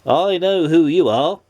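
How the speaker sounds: lightly voiced wizard; a hint of a stern wizard. A narrator's reading.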